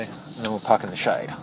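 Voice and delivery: ordinary talk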